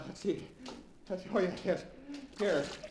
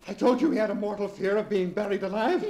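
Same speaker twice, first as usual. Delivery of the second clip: ordinary talk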